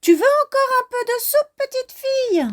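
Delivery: very high, fast piercing call; very high, fast little bear call. Acted. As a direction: call after her like a tiny eager cub. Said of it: "crie de sa petite voix perçante".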